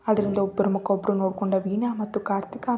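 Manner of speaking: plain reading voice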